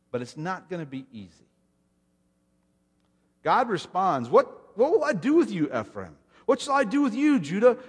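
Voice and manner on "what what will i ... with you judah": high, loud rhetorical appeal as God; exasperated plea. Acted. God addressing Ephraim and Judah, exasperated. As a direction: throw up the question, high and loud, dropping on the first name.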